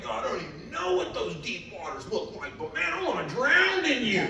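high-pitched, fervent plea rising to a loud peak; impassioned cry to God. Retold or imitated. Young believer crying out to God, impassioned and rising. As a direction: cry out to God with building fervour.

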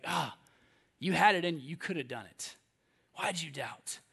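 soft, low, tender reproach; gentle chiding. Acted. Jesus to doubting Peter, gentle chiding. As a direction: chide gently, with a little sigh. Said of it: "it's like a gentle way of chiding us".

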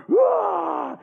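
high acted-out scream; mock scream. Guard pulls his burning arms out, mock scream. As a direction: let out a high mock yell.

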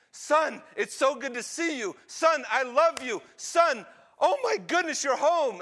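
high, loud cries of joy; overjoyed father. Acted. Father embracing his returned son, overjoyed. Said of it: "he begins to weep with tears of joy".

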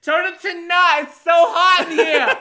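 squeaky overheated guest. Acted. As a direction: whine like you're cooking in the sauna. Sauna customer complaining.

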